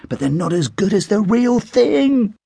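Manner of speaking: ordinary delivery, nothing marked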